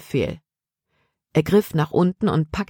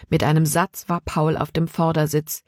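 ordinary delivery

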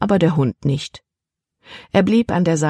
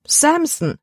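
high-pitched cry of the name; exclaiming; 12 semitones higher than usual. A narrator's reading. Libby reacts to the dog's name, exclaiming. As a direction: exclaim it much higher, in disbelief. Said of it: "rief Libby".

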